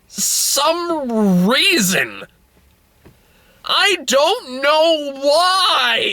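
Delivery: very slow, high-pitched wail; exasperated bafflement. Real, not acted. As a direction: drag every word out in helpless disbelief. Baffled by the bird's tears.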